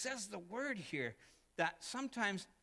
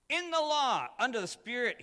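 much higher, louder boast as Paul; proud Paul; 8 semitones higher, 11 dB louder than usual. Retold or imitated. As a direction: quote Paul's boast with full force. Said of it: "he says".

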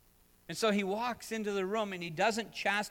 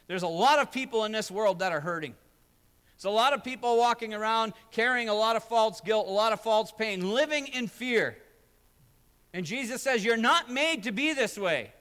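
louder, rising sermon delivery, driving each line home; full-force preaching; 6 semitones higher, 9 dB louder than usual. Acted. Pastor urging hurting people toward hope, preaching hard. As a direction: preach it out to the whole room.

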